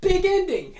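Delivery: hammed-up Big E. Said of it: "but he says".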